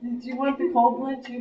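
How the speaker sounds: ordinary talk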